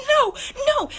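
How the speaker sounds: high, loud shrieked refusal; screechy acted-out yell; mock scream. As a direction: imitate a woman screaming at a stranger. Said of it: "she started screaming at me".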